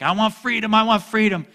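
high, loud mock-demanding; imitating us demanding freedom. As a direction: whine it like a stubborn child. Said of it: "we're like".

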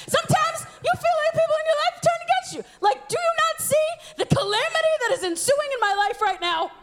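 high, melodramatic wail; mock outrage. Betrayed student appealing over calamity in her life, melodramatic. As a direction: lament it with hammed-up desperation.

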